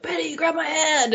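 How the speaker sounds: imitating a hero calling out to a friend; urgent call. Rick O'Connell calls to trapped Benny, urgent high call.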